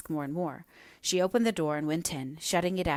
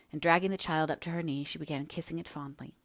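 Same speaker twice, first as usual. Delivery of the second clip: ordinary delivery, nothing marked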